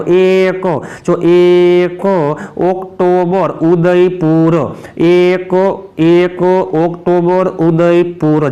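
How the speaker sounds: slow, stretched-out syllables; slow drilling. Shown as a demonstration. Teacher drilling a memory trick for students, slow and drawn out. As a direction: draw out each word for memorising.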